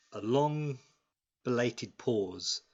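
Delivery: usual speaking voice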